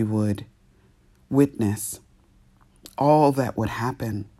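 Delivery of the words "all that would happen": ordinary talk, nothing marked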